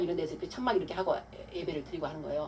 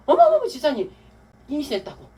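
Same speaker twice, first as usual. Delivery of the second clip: high, loud burst of astonished news; excited retelling; 8 semitones higher, 9 dB louder than usual. Retold or imitated. Mother phones deacon with pregnancy news, excited. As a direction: blurt it out like amazing news.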